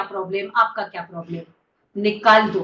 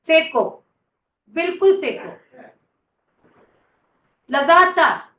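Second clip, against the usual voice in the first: raised, forceful coaching call; urging command; 5 semitones higher, 5 dB louder than usual. Real, not acted. Teacher urging the class to throw it out, forceful call. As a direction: drive the group with a louder, higher push.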